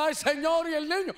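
lightly acted fed-up wail; exasperated parent. Parent fed up with crying child.

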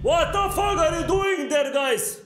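sudden high yelp of surprise; startled outburst. Real, not acted. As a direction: caught off guard, voice jumps up. Startled by animatronic in game.

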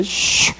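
unvoiced whooshing "shhiu"; soft hissed swoosh; falling whoosh. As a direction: imitate something plummeting. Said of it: "vieron cómo caía".

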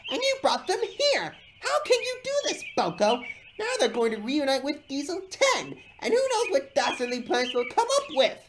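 high, loud, angry rant; heated, high-voiced scolding; snapping. A narrator's reading. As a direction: snap it in high-pitched outrage. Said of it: "snapped James".

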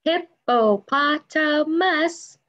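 slow, high, each syllable apart. Shown as a demonstration. Teacher to kids, counting syllables, slow and spaced. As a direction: break hippopotamus into beats. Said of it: "a duck only has one sound".

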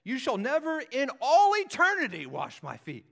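high, loud insistence; emphatic. Retold or imitated. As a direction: hammer home never in all eternity. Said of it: "He's extremely emphatic".

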